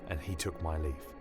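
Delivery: plain reading voice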